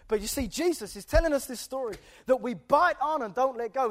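ordinary delivery

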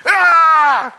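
sharp high yelp of pain; sudden cry. Retold or imitated. Young lad crying out as hands touched his head, high yelp. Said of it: "he suddenly went".

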